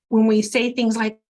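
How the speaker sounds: usual speaking voice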